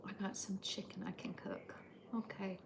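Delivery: hushed note to self; whisper. Real, not acted. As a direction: murmur it privately in a whisper. Whispered aside while cooking.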